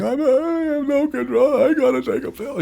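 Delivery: very high hammed-up wail; mock crying. Husband crying to his wife about pills, mock wailing. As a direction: whine it out like exaggerated bawling. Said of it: "go home crying to my wife".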